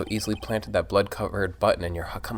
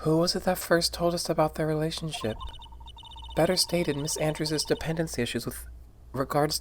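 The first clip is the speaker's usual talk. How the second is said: high character voice; 7 semitones higher, 3 dB quieter than usual. Acted. Voicing a character.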